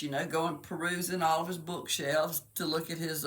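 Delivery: ordinary talk, nothing marked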